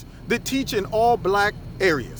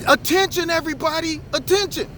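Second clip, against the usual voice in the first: mock bullhorn announcement; 7 semitones higher than usual. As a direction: call it out like a kid playing with a bullhorn. Kid yelling through the bullhorn.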